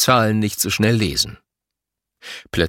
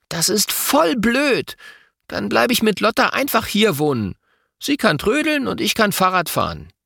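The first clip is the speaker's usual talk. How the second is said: put-on grumbling boy; 12 semitones higher than usual. A narrator's reading. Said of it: "Louis mault". (maulender Junge)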